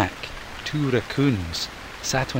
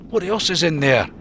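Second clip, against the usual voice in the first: higher raccoon voice; keen for more food; eager Mac; 6 semitones higher, 4 dB louder than usual. Acted. Mac asking Ian, higher eager raccoon.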